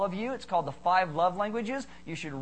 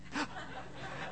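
airy, voiceless gasp through a laugh; whispered laughing gasp; 9 semitones higher, 13 dB quieter than usual. Retold or imitated. Pastor imitating his wife in bed, whispered laughing gasp. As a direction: gasp like she's still overwhelmed. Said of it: "she's like".

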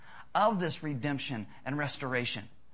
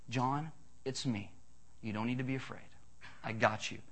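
tender reassurance; 5 semitones lower, 9 dB quieter than usual. Retold or imitated. Jesus to terrified John, soft tender reassurance. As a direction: comfort him gently and quietly. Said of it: "puts his hands on him and says".

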